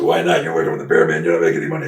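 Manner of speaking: loud retold challenge. Promoter challenging the wrestler's refusal, loud retold challenge.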